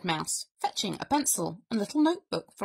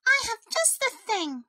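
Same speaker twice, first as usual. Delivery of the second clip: very high little mouse voice; chirpy storybook mouse; too-cheerful mouse; 14 semitones higher than usual. A narrator's reading. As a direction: reassure with relentless cheer, pitched high. Mouse offers a remedy.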